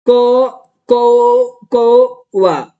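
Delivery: कौवा stretched slowly, syllable by syllable as कौ-वा. Shown as a demonstration. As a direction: spell out कौवा slowly, sounding each syllable. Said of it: "ऐसे बना दोगे". (कौवा धीरे-धीरे, एक-एक अक्षर खींचकर)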